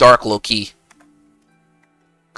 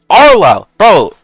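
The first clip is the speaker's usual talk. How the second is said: loud hyped yell; excited shout; 6 semitones higher, 8 dB louder, slower than usual. Real, not acted. Excited shout at chat name.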